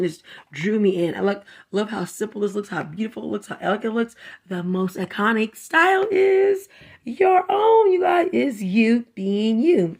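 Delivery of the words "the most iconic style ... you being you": read-aloud quote. Shown as a demonstration. Reading the book's quote.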